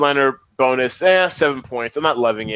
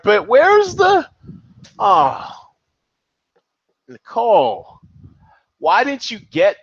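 surprised; 8 semitones higher, 4 dB louder, slower than usual. Real, not acted. Host dismayed at missing headliner.

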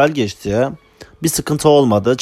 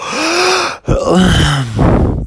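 long heavy sigh; 7 semitones higher than usual. Real, not acted. Tired of shared room.

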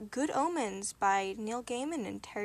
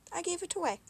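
higher, slightly offended admission; a trifle testy; 8 semitones higher, faster than usual. A narrator's reading. Angel admitting the sword, a trifle testy. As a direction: own up with a hint of pique. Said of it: "he said a trifle testily".

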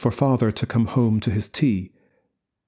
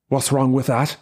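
getting angry; 3 semitones higher than usual. A narrator's reading. Father defends the housekeeping money, angry. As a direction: push back hard on wrong. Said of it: "getting angry".

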